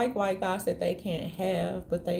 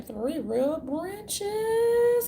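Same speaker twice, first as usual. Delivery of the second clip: each word stretched out slowly and high; slow, drawn-out lecturing stress; very slow emphasis; 10 semitones higher, much slower than usual. Acted. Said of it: "drawn-out emphasis".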